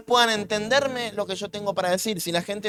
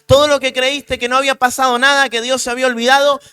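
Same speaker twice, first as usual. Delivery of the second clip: louder, rising preaching climax; predicación a pleno pulmón; 3 semitones higher, 10 dB louder than usual. Real, not acted. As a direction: proclaim the harvest with full force. Predicación a todo volumen. (predicando con toda la fuerza)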